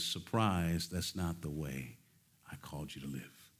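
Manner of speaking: low, soft, slow quiet voice of God correcting; a hushed divine rebuke fading away; God speaking. Pretend. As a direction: let God say it gently and low. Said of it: "And God says".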